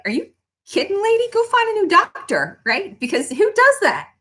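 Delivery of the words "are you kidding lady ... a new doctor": much higher mock outrage; indignant doctor. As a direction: scoff like an offended doctor. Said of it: "He'd be like".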